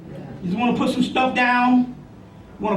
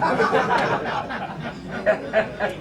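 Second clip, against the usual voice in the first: hearty rising laugh; real laugh; 3 dB quieter than usual. Real, not acted. Laughing at his own Julio joke, real hearty laugh.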